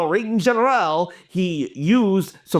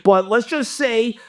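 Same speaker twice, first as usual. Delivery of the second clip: normal talking voice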